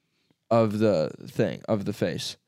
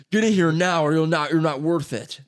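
commanding shout; 5 semitones higher, 4 dB louder than usual. Retold or imitated. Quoting Goggins challenge.

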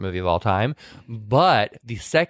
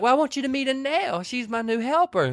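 higher, sweet southern introduction; 12 semitones higher than usual. Retold or imitated. Dolly introducing her new helper to her husband. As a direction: present the new girl brightly. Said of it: "And then she's like".

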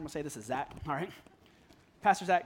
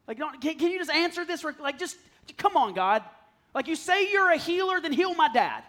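high, loud outburst at God; reenacted frustrated shouting prayer; angry pleading; 8 semitones higher, 8 dB louder than usual. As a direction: plead furiously, voice raised high. Retold angry plea to God.

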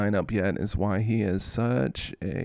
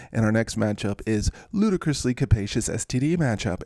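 ordinary talk, nothing marked

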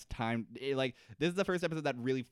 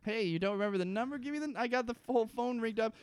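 frantic mock Desmond; 10 semitones higher, faster than usual. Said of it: "acting Desmond frantic on phone".

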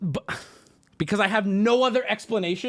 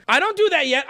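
ordinary talk, nothing marked

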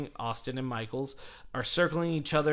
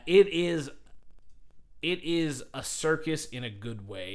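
normal talking voice

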